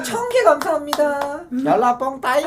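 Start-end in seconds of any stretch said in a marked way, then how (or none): 1.6-2.4 punchy mock Thai fighter cry; Ong-Bak Thai accent. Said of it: "이렇게, 옹박으로".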